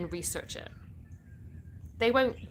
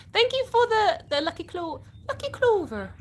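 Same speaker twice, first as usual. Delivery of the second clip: high, loud cartoonish thank-you; playful high thanks; 11 semitones higher, 5 dB louder than usual. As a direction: squeal the thanks in a put-on voice. Thanks viewer for lucky clover gift.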